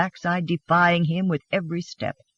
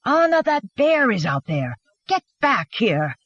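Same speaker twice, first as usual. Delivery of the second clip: urgent warning call, starting high; shouting after them; 4 semitones higher than usual. A narrator's reading. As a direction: order her back forcefully. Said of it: "shouting at their backs".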